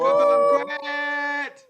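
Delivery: very high, loud announcer call; announcer cheer. Pretend. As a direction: hype it like a game show. Celebrating a critical hit.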